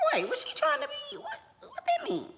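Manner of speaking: high squeaky put-on voice, playing hurt confusion; mock confused squeak. Imitating a confused friend after a jab, high squeaky voice. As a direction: squeak it out like you can't believe what she said.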